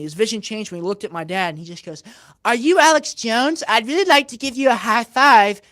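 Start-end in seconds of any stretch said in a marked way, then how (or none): 2.4-5.6 higher, louder schoolyard taunt; juvenile taunt. Retold or imitated. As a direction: imitate a fifth grader on a playground. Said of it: "real juvenile, as if he's, like, a fifth grader".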